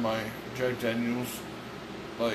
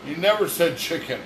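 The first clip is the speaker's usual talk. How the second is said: usual speaking voice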